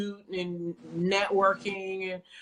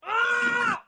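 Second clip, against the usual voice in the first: angry inner rant; 10 semitones higher than usual. Retold or imitated. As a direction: vent the rage at the coach, pitch up. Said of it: "Like, I'm just angry".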